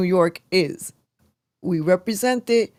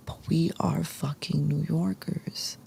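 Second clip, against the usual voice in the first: breathy whisper, no voice; whispered punchline; 9 dB quieter than usual. Real, not acted. Host whispers the closing joke to viewers. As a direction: whisper it like a secret. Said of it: "whispered punchline".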